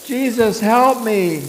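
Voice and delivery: loud, high plea for help; pleading cry. Acted. Children's Bible story cry for help.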